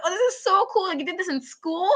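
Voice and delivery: high, delighted gushing; thrilled by her story; excited awe. Retold or imitated. Fan gushing over her schooling, high and delighted. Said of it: "I was like".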